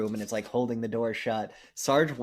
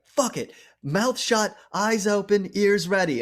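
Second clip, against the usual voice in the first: pumped battle cry; 8 semitones higher, 5 dB louder than usual. Retold or imitated. Soldiers bracing for the werewolf.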